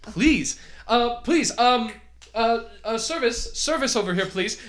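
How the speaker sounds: loud, high call across the room; calling for service. Acted. Douglas calling the server over, loudly. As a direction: summon the server from the back.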